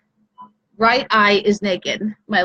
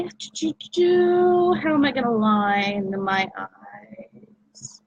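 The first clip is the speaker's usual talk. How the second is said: slow whisper to herself; much slower than usual. Real, not acted. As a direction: whisper it slowly, thinking aloud. Muttering to herself while deciding.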